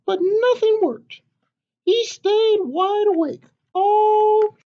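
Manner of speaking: high, slow chick's voice; a tiny hen reading her tale; little chicken storyteller. Acted. Chicken reading her own story to papa, high and slow.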